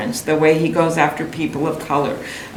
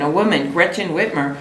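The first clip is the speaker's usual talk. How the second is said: ordinary delivery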